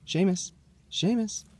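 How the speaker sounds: high sing-song call to a dog. Real, not acted. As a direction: call the dog's name brightly, rising. Calling the dog.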